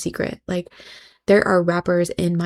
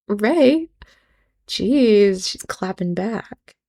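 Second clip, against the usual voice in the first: normal talking voice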